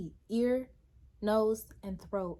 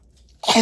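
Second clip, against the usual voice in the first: sneezing; 18 dB louder than usual. Real, not acted. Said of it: "I'm sorry for all the sneezing".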